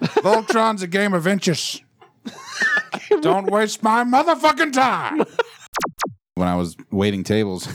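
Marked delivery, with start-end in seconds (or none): 0.0-5.2 high, slow hammed-up coach voice; gritty coach. Joking that Voltron runs on halftime speeches, mock coach. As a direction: bark it like a fired-up coach. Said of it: "I like your Charlie Hustle".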